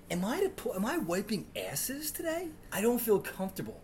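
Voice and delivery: a nervous, out-of-depth plea; flustered. Retold or imitated. Substitute asking the teacher next door for help.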